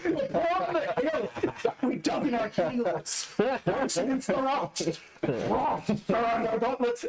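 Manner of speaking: high, put-on innkeeper voice; innkeeper carrying on. Game master voices the innkeeper performing.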